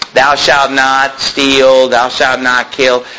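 slow, low, measured scripture recital; solemn recitation. Shown as a demonstration. Preacher recites the Ten Commandments to the congregation, slow and solemn. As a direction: intone each commandment slowly.

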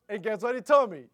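usual speaking voice

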